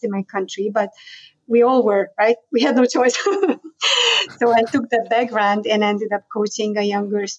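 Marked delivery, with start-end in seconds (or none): 3.2-4.3 a bright high burst of laughter. Real, not acted. Laughing that every child was a gymnast.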